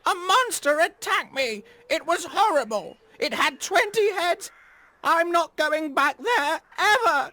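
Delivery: very high, loud panicked crow. Acted. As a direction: babble in terror about the monster. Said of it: "he wailed".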